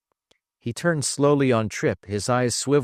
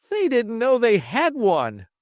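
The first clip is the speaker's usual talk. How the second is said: higher, louder whoop; 13 semitones higher, 4 dB louder than usual. Acted. Dollinger whoops the answer to Travis, pitched up. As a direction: shout the answer in delight. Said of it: "Dollinger whooped".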